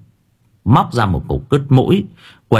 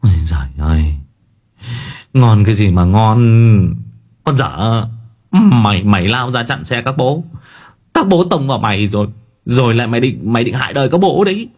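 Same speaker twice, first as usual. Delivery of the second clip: flustered scolding that speeds up; stammering Hải; 2 semitones higher than usual. Acted. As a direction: splutter and build up the scolding. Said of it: "Thằng Hải lắp bắp".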